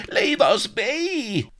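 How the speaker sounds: very high, drawn-out loud shriek. A narrator's reading. Solomon is grabbed by the throat, shrieking. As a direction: shriek to be let go. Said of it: "He shrieked as Blood grabbed him".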